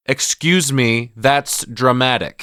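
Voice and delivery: imitating John Malkovich-style loud slow emphasis. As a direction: get in their face and drag it out. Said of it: "speaks loudly and slowly to prove a point".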